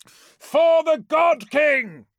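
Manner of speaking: slow, soaring war cry, very high and loud. Acted. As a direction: cry it out to rally the army. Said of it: "I cry".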